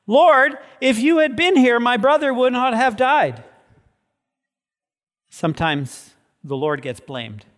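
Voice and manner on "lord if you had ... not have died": high, loud, grieving plea; Mary's anguished plea. Acted. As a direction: plead like a sister who lost her brother. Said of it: "Not just Martha, Mary".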